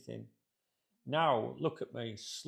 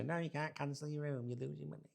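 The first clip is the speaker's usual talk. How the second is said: higher, officious clerk; hotel clerk voice; 4 semitones higher than usual. Retold or imitated. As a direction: play the smug hotel desk. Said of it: "if they say".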